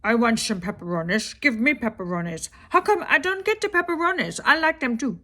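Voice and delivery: whiny pleading cat with slushy sh sounds, pitch climbing; Truffles voice. Acted. Pet cat begging for pepperoni at dinner, slurred pleading cat voice. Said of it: "we talk as if we're Truffles".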